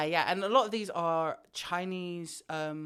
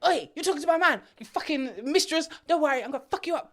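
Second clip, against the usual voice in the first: much higher, louder threatening yell; furious bride; 10 semitones higher, 4 dB louder, faster than usual. Retold or imitated. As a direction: storm up and confront the mistress. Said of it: "and like".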